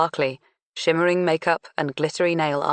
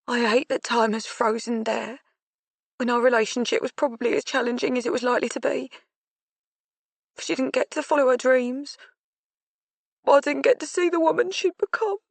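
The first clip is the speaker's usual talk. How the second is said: higher, rising toward tears; grieving; 8 semitones higher than usual. Acted. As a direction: voice climbing with grief. Grieving mother, face trembling.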